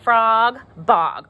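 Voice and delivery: Frog and bog said with a lift on the shared ending; teacher stressing the rhyme for kids; rhyming words stressed. Shown as a demonstration. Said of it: "they even both end in O G".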